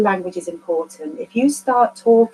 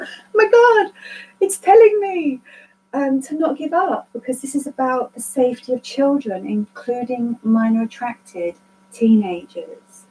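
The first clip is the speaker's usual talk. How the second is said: awestruck realisation. Retold or imitated. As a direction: burst out as if receiving a sign. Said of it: "And I thought".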